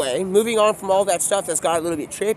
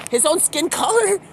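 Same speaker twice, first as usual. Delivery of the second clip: high incredulous repeat; 9 semitones higher than usual. Retold or imitated. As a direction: pitch jumps up in disbelief. Repeated, pitched up.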